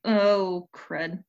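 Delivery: ordinary talk, nothing marked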